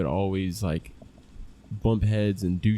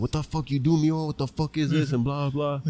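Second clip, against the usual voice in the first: mock scolding father, voice pitched up; imitating angry dad; 5 semitones higher than usual. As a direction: retell dad's anger higher, without shouting. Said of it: "gets real red in the face".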